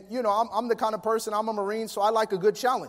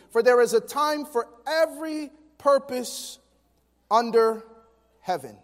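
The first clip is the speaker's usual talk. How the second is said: scripture reading; 3 semitones higher, 5 dB louder than usual. Acted. Reads Ecclesiastes aloud.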